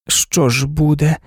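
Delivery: slow, weary, downcast question; a touch of a despondent mother; dejected. A narrator's reading. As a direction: ask it as if already beaten. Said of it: "пригнічено запитала професорова".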